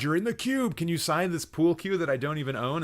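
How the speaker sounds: high, loud gushing fan; starstruck fan gush. Retold or imitated. Fanboy meeting the guy from Cube, gushing. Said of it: "been like".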